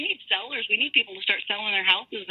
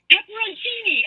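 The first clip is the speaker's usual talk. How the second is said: PEPPERONCINI said high, quicker than the stretched-out take; emphatic repeat; 10 semitones higher than usual. Retold or imitated. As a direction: imitate an insistent repeat of "Pepperoncini", pitched way up.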